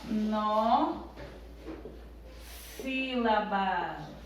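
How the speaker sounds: "Monossílabas" very slowly, syllable by syllable with long pauses; separando em sílabas. Shown as a demonstration. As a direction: stretch out each part of "Monossílabas", pausing between them. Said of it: "a gente vai separar as palavras em". ("Monossílabas" bem devagar, separando as sílabas)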